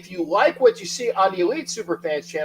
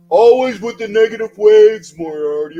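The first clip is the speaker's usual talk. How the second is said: loud quoted movie line in a put-on voice; movie quote; 7 semitones higher, 12 dB louder than usual. Host quotes a film at a viewer, loud acted line. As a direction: bark the line like the film character. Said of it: "There's a movie reference".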